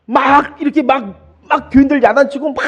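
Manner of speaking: a loud, hammed-up scolding preacher; mock scolding. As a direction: ham up a pastor yelling at his flock. Mimics a scolding pastor.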